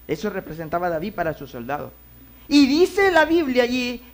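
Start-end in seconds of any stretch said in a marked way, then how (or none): 2.5-4.0 loud, high preaching cry. Acted. Preacher calling out to the congregation, loud. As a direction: preach it out to the church.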